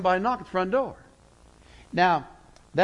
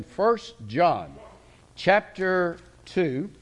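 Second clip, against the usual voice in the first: slow emphasis; much slower than usual. Acted. Preacher repeating the text reference.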